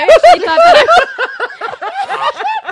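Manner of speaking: high, loud, slow words broken by laughter; laughing out loud. Real, not acted. Telling friends about a date, laughing too hard to finish. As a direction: she can't get the words out for laughing. Breaks up laughing mid sentence.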